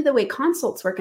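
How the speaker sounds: normal talking voice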